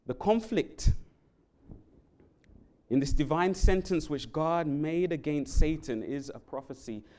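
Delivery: normal talking voice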